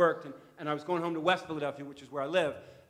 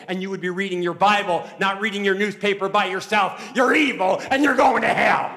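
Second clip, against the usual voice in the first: hammed-up preacher tirade; preacher scolding; 3 semitones higher, 12 dB louder than usual. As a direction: condemn him to his face.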